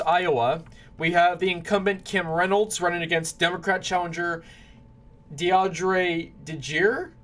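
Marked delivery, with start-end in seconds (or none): 5.3-7.1 "DeAndre DeGier" sounded out very slowly; unsure pronunciation. Real, not acted. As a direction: pick through "DeAndre DeGier" syllable by syllable, unsure of it. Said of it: "I know for a fact I'm not saying right".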